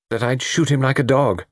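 understated grimness; grim. A narrator's reading. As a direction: finish the sentence grimly. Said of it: "said de Langueur grimly".

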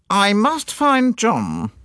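high, slow and coldly dismissive; put-on wife's voice, icy and drawn out. A narrator's reading. Offended wife leaving Poirot, said coldly. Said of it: "got up and said coldly".

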